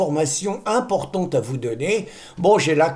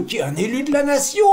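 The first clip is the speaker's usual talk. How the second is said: mock outrage; 7 semitones higher, faster than usual. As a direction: shout it with fake outrage. Mock-pompous build-up, shouted.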